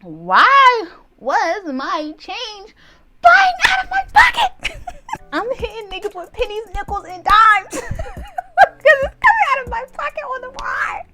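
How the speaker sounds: very high, loud comic shrieking through laughter; outraged shriek. Retold or imitated. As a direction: wail in disbelief, cracking up. Outraged laughing shriek over change.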